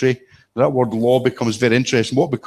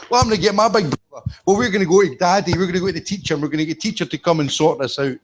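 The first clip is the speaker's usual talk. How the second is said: put-on childish retort; 7 semitones higher than usual. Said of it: "This is five-year-old in a playground".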